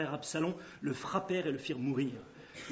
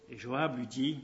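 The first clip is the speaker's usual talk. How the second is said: ordinary delivery, nothing marked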